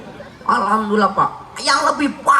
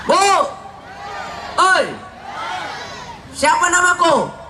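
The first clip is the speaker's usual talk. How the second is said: calling out; 7 semitones higher than usual. Real, not acted. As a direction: drag the question out to the whole hall. Calling out to the crowd.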